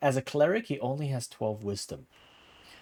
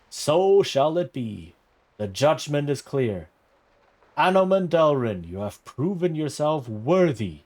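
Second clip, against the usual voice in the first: booming prelate; 6 dB louder than usual. Acted. Prelate questioning the squire at his knighting, booming. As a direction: proclaim like a judge in a great hall.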